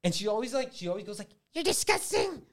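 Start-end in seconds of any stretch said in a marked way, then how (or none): 1.5-2.4 very high squeaky sneer of the evil daughter; chipmunk voice. Retold or imitated. Comedian mocking the evil daughter's snotty chipmunk squeak. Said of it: "reminds me of chipmunks".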